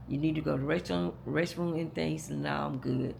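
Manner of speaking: normal talking voice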